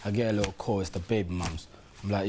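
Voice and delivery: ordinary talk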